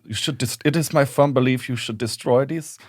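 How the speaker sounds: solemn German-accented imitation; measured, grave verdict in a German accent; Werner Herzog. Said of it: "I love Werner Herzog in that".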